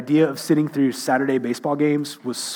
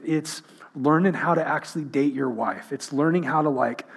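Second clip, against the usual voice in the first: ordinary delivery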